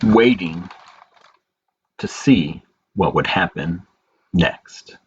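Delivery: put-on slow, gentle read-aloud for children; story narration. A narrator's reading. Librarian reading a picture book to children, gentle storytelling.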